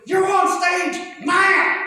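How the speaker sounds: high, slow frantic shout; club owner shouting. Retold or imitated. Club owner yelling at the new comic to go on. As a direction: yell him onto the stage right now. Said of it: "She goes".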